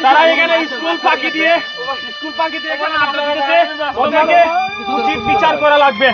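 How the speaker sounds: loud, high shouted accusation; furious ranting. Acted. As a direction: rage at full volume demanding punishment. Rages about the truants.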